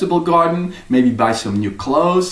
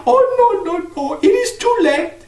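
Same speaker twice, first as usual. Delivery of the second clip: put-on old crone. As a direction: question him like an old crone. Old woman warns.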